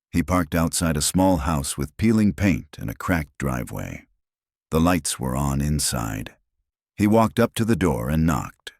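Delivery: usual speaking voice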